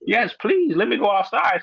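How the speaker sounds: high, pleading yes please; begging the nurse, still high; eager plea. Retold or imitated. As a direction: plead to go outside. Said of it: "And I'm like".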